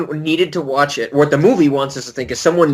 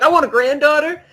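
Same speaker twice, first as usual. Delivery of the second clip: much higher, laughing, careless whim; mock rich grandfather; 9 semitones higher than usual. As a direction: toss off a granddaughter order lightly. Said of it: "He was like".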